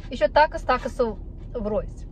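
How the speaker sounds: normal talking voice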